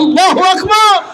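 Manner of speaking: very high-pitched ringing shout; rallying takbir. Real, not acted. Preacher rousing listeners after the story, rallying shout. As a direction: throw the cry high to rouse the crowd.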